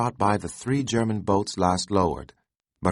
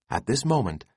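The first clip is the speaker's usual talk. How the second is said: ordinary delivery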